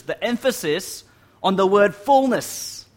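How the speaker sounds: "fullness" slow, high and loud; stress on "fullness". Real, not acted. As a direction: hit "fullness" hard and draw it out. Points out the emphasised word.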